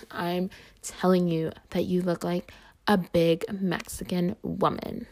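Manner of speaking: a put-on boyish mocking voice, teasing; mocking his voice. Mocking the classmate who insulted her, in his put-on voice. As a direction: imitate the classmate's smug put-down. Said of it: "this is what he really sounded like".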